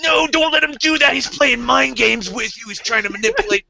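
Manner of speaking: squeaky warning whisper-free, urgent and high; mock frantic table warning; high mock Tim voice. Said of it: "he's the guy who's always like".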